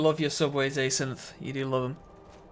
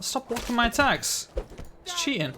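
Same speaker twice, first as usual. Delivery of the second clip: mock whiny complaint; 7 semitones higher than usual. Real, not acted. Complaining at game opponent.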